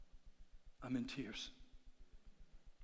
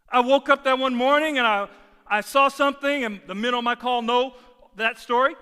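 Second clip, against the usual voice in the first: loud, high, emphatic sermon delivery; full-force preaching; 9 semitones higher, 18 dB louder than usual. Real, not acted. Preacher urging the congregation to confess to God, full-force preaching. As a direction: drive every phrase home to the congregation.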